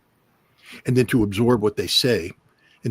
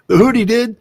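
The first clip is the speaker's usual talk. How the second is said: triumphant punchline; 13 semitones higher, 7 dB louder than usual. Acted. Repeated punchline.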